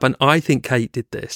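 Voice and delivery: normal talking voice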